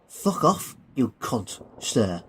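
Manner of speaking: mock-respectful insult. Billy saluting the Colonel, insolently mock-respectful. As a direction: repeat the insult as if obeying protocol. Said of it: "turning back round and smartly saluting".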